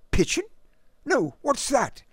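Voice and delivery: put-on very high, puzzled English gent's accent; lofty British accent. A narrator's reading. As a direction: query it innocently in a clipped British accent. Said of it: "in his lofty, clipped accent".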